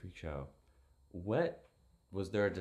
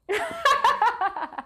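surprised laugh; 8 dB louder than usual. Real, not acted.

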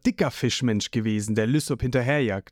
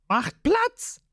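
Lysop heroic; slower than usual. A narrator's reading. Lysop stands up bravely.

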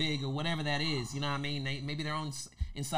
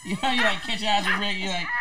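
ordinary talk

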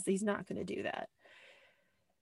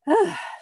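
worked-up sigh; 10 semitones higher, 11 dB louder than usual. Real, not acted. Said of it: "I'm getting myself worked up".

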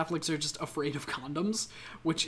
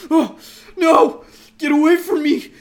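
mock horrified yell; 12 dB louder than usual. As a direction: recoil and scream like a horror victim. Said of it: "and he's like".

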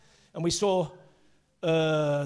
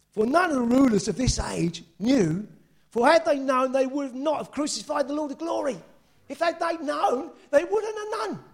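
raised, rising preacher's proclamation; emphatic preaching; 7 semitones higher than usual. Real, not acted. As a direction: drive the verse home from the pulpit.

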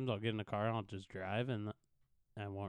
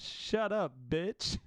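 higher, louder mimicked outburst; retold insult; 6 semitones higher, 4 dB louder, slower than usual. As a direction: imitate the kid mouthing off, pitched up. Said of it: "And he says".

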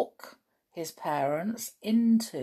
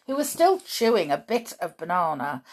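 ordinary delivery, nothing marked

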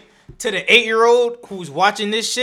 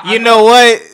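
laughing loud; 3 semitones higher, 15 dB louder than usual. Real, not acted.

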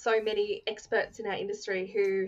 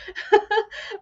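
loud high laugh; real amused laugh, louder; burst of laughter; 11 semitones higher, 7 dB louder than usual. Real, not acted. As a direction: laugh out loud, much higher. Laughing about being scared.

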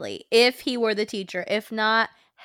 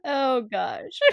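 ordinary talk

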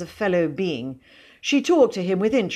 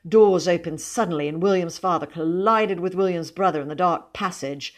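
usual speaking voice

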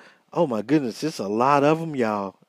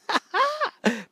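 high cackle. Real, not acted. Laughing at own joke.

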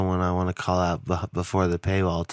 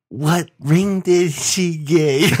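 much higher, louder, slow campy gush; mock camp voice; 9 semitones higher, 7 dB louder, much slower than usual. Dad teasing his buddy about the ring, campy gush. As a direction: gush over the ring to tease him.

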